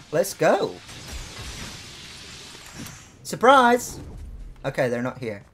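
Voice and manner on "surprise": playful shout. Real, not acted. Bursting in on enemies.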